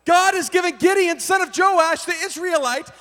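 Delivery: urgent, exasperated friend; sober, alarmed friend. Retold or imitated. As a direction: snap him out of it with alarm. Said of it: "who was clearly sober".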